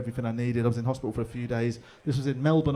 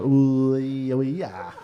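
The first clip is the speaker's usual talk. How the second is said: torn hesitation; 4 dB louder, much slower than usual. Retold or imitated. Hesitating at gig offer.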